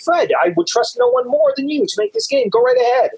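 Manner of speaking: the author replies to his friend Fred about the game; mock eager approval. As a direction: hand it over with exaggerated trust. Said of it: "And I'd be like".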